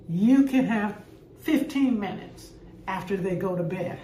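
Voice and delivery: ordinary talk, nothing marked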